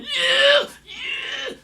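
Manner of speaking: very high strained rock-singer wail. Retold or imitated. Mocking how a politician sounds, strained high rocker cry. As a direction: squeeze out a long high "yeah". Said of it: "she sounds like [name], always going".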